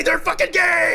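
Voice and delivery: very high, loud slow shout; joyful shout. Real, not acted. Streamer celebrating the couple, shouting. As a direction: scream it in triumph.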